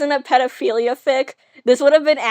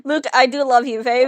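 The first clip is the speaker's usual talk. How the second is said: ordinary talk, nothing marked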